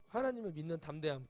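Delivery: usual speaking voice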